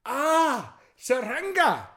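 high, loud "aha" exclamation; sudden realisation. Retold or imitated. Villager suddenly grasping the intended word, acted high excited exclamation. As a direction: burst out as the meaning finally lands. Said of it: "suddenly he goes".

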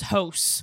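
"hose" for house, with a tight O vowel; Nova Scotian O. Shown as a demonstration. Host demonstrates her local O's, Nova Scotian "hose". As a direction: say house so it sounds close to "hose". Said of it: "Yeah, the O's".

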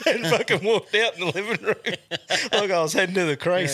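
high, laughing retelling. Real, not acted. Telling mates about sleepwalking as a kid, laughing. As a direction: speak it through real laughter.

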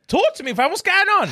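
high, loud, eager prompting; excited. Real, not acted. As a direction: hype him up to talk. Urging friend to rant.

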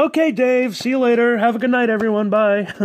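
high, sing-out goodbye voice; put-on chirpy send-off; cheery farewell, imitated. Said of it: "And he's like".

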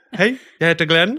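laughing, hammed-up Gothenburg dialect, high and loud; a mock Gothenburg guy introducing himself; Gothenburg accent. Friend attempts Gothenburg accent on a podcast, laughing. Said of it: "Nu vill jag höra dig prata göteborgska".

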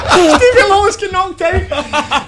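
high squealing laughter through words; can barely get it out for laughing; laughing hysterically. Real, not acted.

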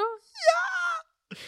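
high squeal. Real, not acted. Sudden very high exclamation.

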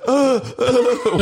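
high mock gagging noises; imitated disgusted retching. Imitating wife biting a disguised egg, high mock gagging. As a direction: gag in a high voice at a nasty mouthful. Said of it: "totally bit into an egg and was".

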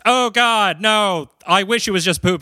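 hammed-up disgusted dread; mock horror. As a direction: recoil in exaggerated dismay. Acting out horror at human filth.

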